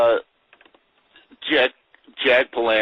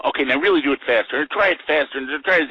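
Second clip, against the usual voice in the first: higher, lightly voiced urgent direction; imitated pushy director; 6 semitones higher, 4 dB louder, faster than usual. Director urging actor through repeated takes, mock pushy. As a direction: push the actor along, a touch higher.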